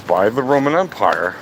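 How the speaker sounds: usual speaking voice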